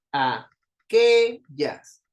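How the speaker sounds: aquellas broken slowly, middle QUE swelling loud; stress on QUE. Shown as a demonstration. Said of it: "Suena más fuerte la sílaba de en medio". (aquellas muy despacio, alargando fuerte la QUE)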